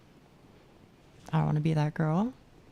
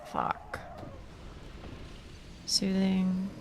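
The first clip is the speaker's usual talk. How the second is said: ordinary delivery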